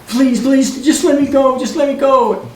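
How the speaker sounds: high, pleading voice of the upset lady; pleading woman. Retold or imitated. Crying lady begging Glenn to let her go, high plea. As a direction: beg to be let go.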